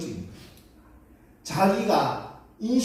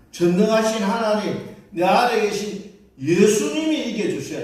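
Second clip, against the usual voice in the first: louder, higher full-force preaching; preaching climax; 7 semitones higher, 6 dB louder than usual. Acted. Pastor proclaiming Jesus' victory to the congregation, full force. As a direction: proclaim it to the whole church.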